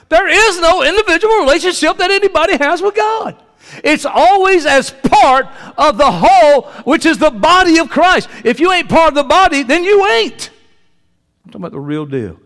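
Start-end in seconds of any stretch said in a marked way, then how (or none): 0.1-10.5 high, loud preacher's cry, slowed on the body of Christ; preaching at full force. Acted. Preacher tells the church there's no solo faith, full-force preaching. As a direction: lift it up and drive it home to the congregation.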